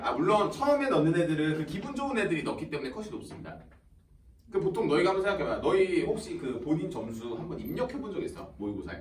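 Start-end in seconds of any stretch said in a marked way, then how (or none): none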